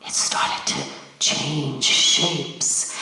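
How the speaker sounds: hushed, slow whisper; spooky storyteller's whisper; suspense whisper. A narrator's reading. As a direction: breathe it out like a secret. Narrator builds suspense.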